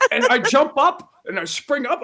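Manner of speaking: narrating through laughter; laughing. Real, not acted. Laughing while telling.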